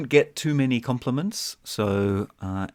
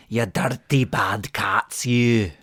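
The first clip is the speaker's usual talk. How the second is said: put-on Scottish accent; slower than usual. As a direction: growl at the cats in a Scottish accent. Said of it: "Like a Scottish".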